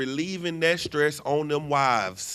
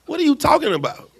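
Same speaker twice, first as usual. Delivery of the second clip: much higher, fast disbelief; scoffing at an absurd claim; incredulous; 13 semitones higher, 7 dB louder, faster than usual. Real, not acted. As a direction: throw the question up in disbelief. Mocking Trump's remark.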